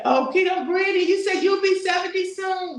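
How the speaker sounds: loud, high, delighted reading of the comment; gushing over the viewer's compliment, pitch up; excited read-out. Retold or imitated. Reading a fan's comment to the livestream, loudly thrilled.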